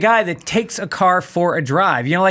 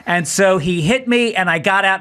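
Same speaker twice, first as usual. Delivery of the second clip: ordinary delivery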